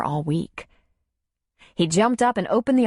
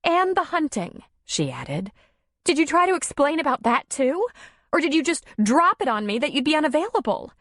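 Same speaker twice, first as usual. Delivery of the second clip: high, accusing questions; mild reproach; 9 semitones higher than usual. A narrator's reading. Darla accusing Tom of dropping plans, reproachful. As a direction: needle him about it.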